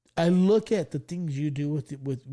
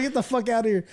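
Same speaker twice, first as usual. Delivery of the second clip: higher-pitched retold dismissal; mock-angry order to leave; angry teacher; 7 semitones higher, 4 dB louder than usual. Teacher throws the class clown out, mock angry. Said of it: "He got so mad".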